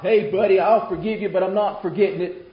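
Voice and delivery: loud, high mock pardon; grudging forgiveness. What Jesus didn't say to the thief, loudly grudging. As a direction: push it out hard, still holding the grudge.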